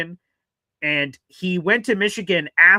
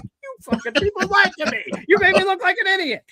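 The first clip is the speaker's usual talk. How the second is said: much higher indignant outburst; mock angry rant. Burned by fake news, high indignant complaint. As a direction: vent at the liars, high and wound up. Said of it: "I'm like".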